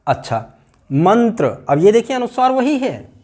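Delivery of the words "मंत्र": normal talking voice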